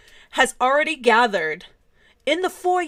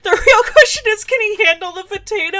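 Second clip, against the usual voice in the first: laughing; 10 semitones higher, 8 dB louder than usual. Real, not acted. Laughing at her own joke.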